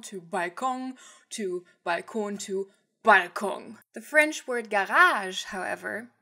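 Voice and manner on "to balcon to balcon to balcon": balcon said several ways, slowly; slowly vary how balcon sounds; changing pronunciations. Shown as a demonstration. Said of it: "the pronunciation differs".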